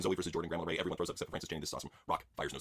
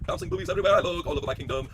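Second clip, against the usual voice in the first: ordinary talk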